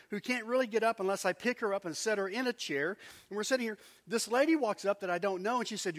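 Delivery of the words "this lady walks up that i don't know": ordinary talk, nothing marked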